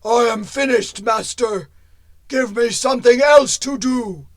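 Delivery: loud, heavy stone golem. Acted. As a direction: boom it out like a stone giant. Golem demands work.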